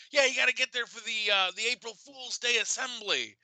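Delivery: high, sing-song teasing; teasing reveal. Retold or imitated. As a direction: gloat gently over the prank. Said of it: "I was just like".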